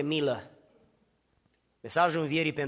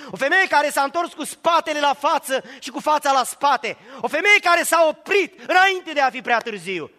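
loud, high, rising sermon climax; preaching at full force; 10 semitones higher, 9 dB louder than usual. Acted. Preacher to congregation, loud full-force preaching. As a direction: preach it out, building the list.

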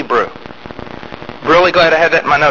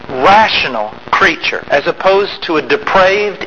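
ordinary talk

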